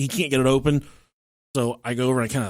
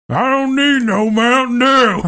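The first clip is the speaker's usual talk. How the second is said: loud, high, drawn-out mock holler; hammed-up yell from a struggling man; straining husband bellowing; 9 semitones higher, 7 dB louder, slower than usual. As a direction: wind up and bellow it slowly. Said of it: "I can hear him winding up to talk".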